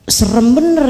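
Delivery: awed dread. Retold or imitated. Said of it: "saya bacanya sampai yang".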